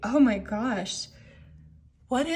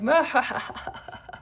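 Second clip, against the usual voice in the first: mock evil laugh; 5 semitones higher than usual. Voiced villain laughing at trap.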